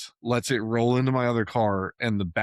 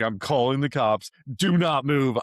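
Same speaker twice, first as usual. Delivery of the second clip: raised, firm mock order to the other driver; a retold angry order, louder and higher; angry command; 4 semitones higher, 4 dB louder than usual. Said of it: "I was like".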